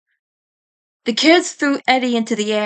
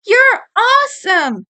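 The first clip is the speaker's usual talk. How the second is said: much higher, louder, slow cheer; excited cheer; 11 semitones higher, 4 dB louder than usual. Acted. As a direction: burst out in praise. Naz cheers Eddie.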